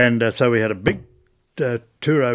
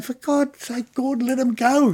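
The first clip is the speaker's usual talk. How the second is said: mock exasperation; 13 semitones higher than usual. Colleagues exasperated at chatty guide.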